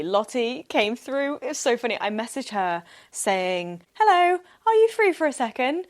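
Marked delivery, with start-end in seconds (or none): 3.9-5.9 high, louder, sugary ask; butter her up with a sweet high hello; sweetly asking a friend for a favour. Retold or imitated.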